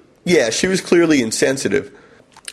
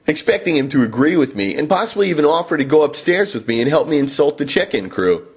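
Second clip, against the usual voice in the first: ordinary delivery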